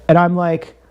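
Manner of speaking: usual speaking voice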